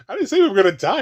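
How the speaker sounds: high, indignant disbelief; mock outrage. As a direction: act baffled and offended. Mocking character's dialogue.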